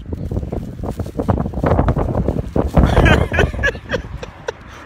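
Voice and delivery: a long real burst of laughter; bitter amused laugh. Real, not acted. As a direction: laugh it off, rueful. Laughing about daily struggle.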